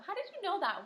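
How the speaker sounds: high, wowed praise; teacher pretending to be amazed; mock amazement. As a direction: act impressed with the student. Praising a student's answer.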